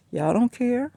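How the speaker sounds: high-pitched exasperated jab. Retold or imitated. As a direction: fling it up high in disbelief. Scolding callers, pitch jumps.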